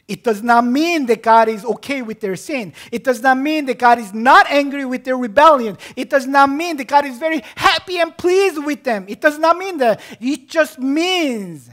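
high, loud, insistent pulpit refutation; full-force preaching. Real, not acted. Preacher correcting what God's love means, full-force preaching. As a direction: hammer out each denial, stretching the final phrase.

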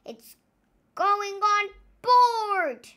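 Naughty Paul's voice. A narrator's reading. Naughty Paul warning the crew, slow and loud. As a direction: draw it out slowly in the pirate voice. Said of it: "said Naughty Paul".

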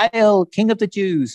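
high, mocking royal salute; mock hail. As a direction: jeer it like a sneering soldier. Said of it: "they began to call out to him".

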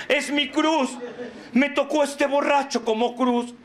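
high-pitched put-on lament; mock martyr wife. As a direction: complain as a long-suffering wife, hammed up. Mimics a self-pitying martyr wife.

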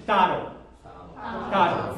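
"daro" and "Tado" stressed on the first syllable. Shown as a demonstration. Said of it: "Very clearly first".

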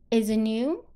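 "Is it new" with "new" swept upward; rising question tune. Shown as a demonstration. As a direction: let the end of "Is it new" curl up. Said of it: "把声音往上翘". (“Is it new”句尾上扬)

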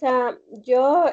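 ordinary delivery

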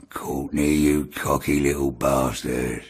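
low, slow menacing growl. Retold or imitated.

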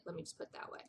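hushed, knowing aside; quiet pointed remark; whispered aside. Real, not acted. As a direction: say it under your breath. Pointed aside about town.

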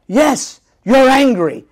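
escalating angry exercise line, nearly yelling; heated repetition shout. Shown as a demonstration. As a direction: let the repetition boil over into a shout. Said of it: "sometimes in the acting exercise".

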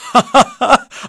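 short high amused laugh; a quick chuckle. A narrator's reading. Herr Bernheimer chuckling at the boys. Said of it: "lacht Herr Bernheimer".

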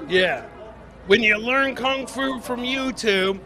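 usual speaking voice

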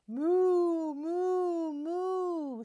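high, loud mooing cow. Acted. Cow tries crowing to wake the farm, high loud moos. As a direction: moo like a cow trying to crow. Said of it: "she crowed".

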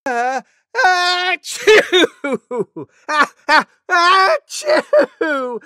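high, loud, drawn-out mock sneezes; huge disgusting sneeze. Deer demonstrates an elephant's sneeze, huge high pretend sneezes. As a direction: wind up and explode in stretched achoos. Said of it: "huge, disgusting sneeze like this".

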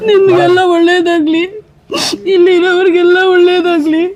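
high, tearful voice breaking; a mother weeping as she blesses everyone; sobbing blessings. Real, not acted. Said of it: "ಅಳ್ಬೇಡಿ, ಅಳ್ಬೇಡಿ ಅಮ್ಮ". (ಅಳುತ್ತಾ ಆಶೀರ್ವಾದ)

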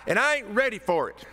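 high, loud plea with "ain't" pushed up; Wooster voice. Retold or imitated. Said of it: "In my best Wooster voice".

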